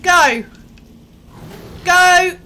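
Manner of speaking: loud, high yell to run; frantic shouted go; urgent shout. Real, not acted. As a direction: shout to bolt now. Running from monks.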